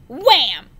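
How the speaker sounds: loud punchy exclamation; sound effect. A narrator's reading. Narrator voices the thud of a head on the doorway. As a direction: punch it like an impact.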